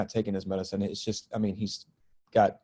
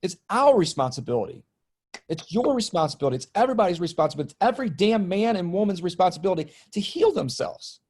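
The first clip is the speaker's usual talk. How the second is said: higher and a bit louder, fervent sermon build; impassioned preaching; 8 semitones higher, 3 dB louder than usual. Real, not acted. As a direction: drive the message home to everyone. Impassioned preaching about healing.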